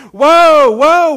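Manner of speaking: loud, high drawn-out whoa; panicked rider calling. Acted. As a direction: holler to halt a runaway horse. Rider trying to stop runaway horse.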